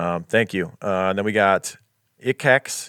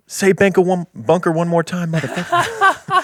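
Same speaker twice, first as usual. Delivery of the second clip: high, hammed-up menacing movie line with a squeaky top on motherfucker; mock tough-guy line that leaps way up at the end; quoting the bunker line; 8 semitones higher than usual. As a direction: redo the bunker line as a cartoonish threat. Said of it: "they liked that line from our, uh, bunker episode".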